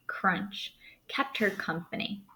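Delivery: breathy whisper of snow crunching. A narrator's reading. Whispered to the class as footsteps crunch. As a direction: hush it like soft footsteps in snow. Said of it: "gentle sounds of the child's footsteps".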